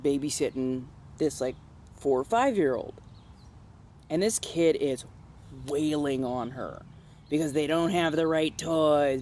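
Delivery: ordinary talk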